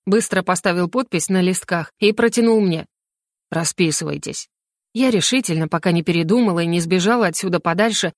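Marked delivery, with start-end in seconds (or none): 3.5-4.5 low, quieter official's order; understated command in a lowered voice; curt mage. A narrator's reading. Mage orders her to sign. (низким голосом, сухой приказ)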